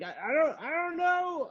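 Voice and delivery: very high, timid imitation of himself; meek high voice. Narrator answering the lady helplessly, very high meek mock voice. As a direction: play yourself small and helpless. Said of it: "And I'm like".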